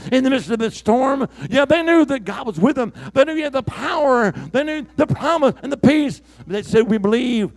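high, loud revival preaching; preaching at full force. Acted. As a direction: lift the whole room as you preach.

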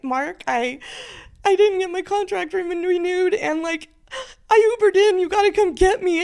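high, tearful plea to her husband; calling her husband in distress, high and upset. Retold or imitated. Calling husband after losing job, upset.

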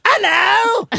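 high, hammed-up British hello; a mock posh British greeting, pitched high; British accent. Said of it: "can you do a British accent?".

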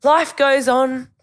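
high, loud, slow shrug-it-off mum; breezy mum. Retold or imitated. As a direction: toss it away like nothing matters, drawn out. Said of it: "She just goes".

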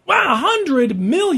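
drawn-out amazed gasp; surprised. Real, not acted. Reacts to payout figure.

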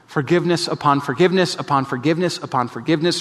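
ordinary talk, nothing marked

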